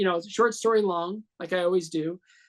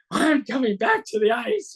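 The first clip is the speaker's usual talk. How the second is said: much higher, thrilled; excited inner thought; 12 semitones higher, 4 dB louder than usual. Retold or imitated. Thinking about his return to the ice, excited and high. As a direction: lift it up with excitement.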